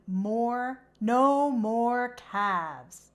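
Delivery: ordinary delivery, nothing marked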